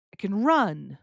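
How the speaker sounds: "run" long and falling, "can" light; stress on RUN. Shown as a demonstration. Teacher shows what we can do, stress on "run". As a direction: land on the verb "run". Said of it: "put the stress on the verb".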